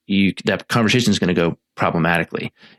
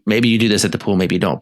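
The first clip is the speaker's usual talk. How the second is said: ordinary talk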